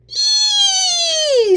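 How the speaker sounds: very high long squeal; pig squeal. Acted. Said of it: "squealed Pig".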